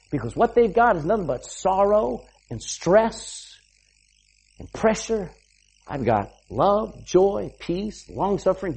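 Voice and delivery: ordinary delivery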